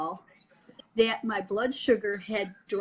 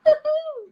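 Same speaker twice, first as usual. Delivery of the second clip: loud, very high gleeful whoop; shrieky laughing whoop; 7 dB louder than usual. Real, not acted. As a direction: let out a high celebratory hoot. Answers the song with a whoop.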